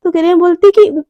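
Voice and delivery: ordinary delivery, nothing marked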